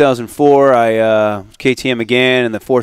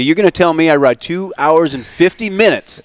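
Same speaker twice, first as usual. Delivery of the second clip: mock outrage, pitched up a little; indignant disbelief; 5 semitones higher than usual. Complaining to himself about a failed finish, mock outraged. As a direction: protest being cheated after hours riding. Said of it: "I go".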